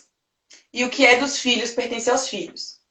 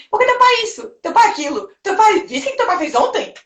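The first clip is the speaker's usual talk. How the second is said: mother complaining; 9 semitones higher than usual. Retold or imitated. Mother complaining about father to child.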